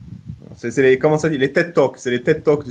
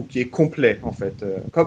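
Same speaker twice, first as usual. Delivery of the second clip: normal talking voice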